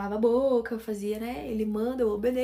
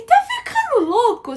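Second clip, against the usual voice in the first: much higher, louder disbelieving cry; incredulous outburst; 12 semitones higher, 9 dB louder than usual. Retold or imitated. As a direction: scold him: are you crazy?. Scolding boyfriend who ignores delivery.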